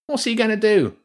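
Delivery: put-on a rat dismissing the kid; scornful rat. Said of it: "the first rat said".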